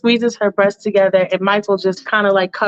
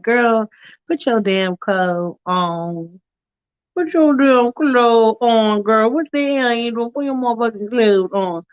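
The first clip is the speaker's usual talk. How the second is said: mock scolding. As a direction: drag the words out in comic exasperation. Acted command as shirt is thrown.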